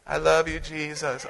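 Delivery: mock sweet worship. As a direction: softly back to hushed devotion. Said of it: "making the fool out of myself".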